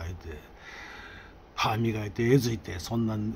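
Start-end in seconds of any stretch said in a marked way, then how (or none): none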